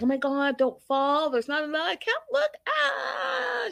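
high mock panic rising to a squealing cry; imitating panicked mom. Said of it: "she's freaking out".

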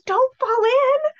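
high, slow, anxious mom warning; worried mom. Retold or imitated. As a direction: plead like a nervous mother. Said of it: "she was very serious about this".

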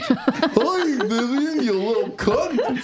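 high, drawn-out silly squeak; hammed-up cartoon character on the edge of laughing. Cartoon character swearing at the child, high goofy voice. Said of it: "we switched".

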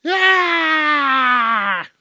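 put-on high, loud old man war cry; old man battle yell. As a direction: let out a shrill fighting yell. Old man swings walker.

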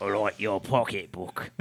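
put-on slow, low English accent; creepy compliment in broad mock English; drawling old English pleasantry. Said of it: "Do that voice".

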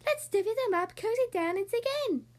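a friendly badger with a grin offering treats; grinning badger. Badger offering honey nuts to the friends, grinning high voice. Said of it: "Badger says with a grin".

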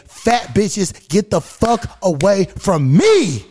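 exasperated, slow and emphatic demand; fed-up telling-off; blunt, direct. Acted. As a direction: raise the pitch and punch each word, building to a drawn-out end. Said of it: "now I gotta be direct and say".

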